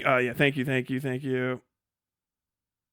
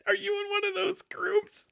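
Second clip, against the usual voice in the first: laughing; 7 dB quieter than usual. Real, not acted. Laughing, asking chatter.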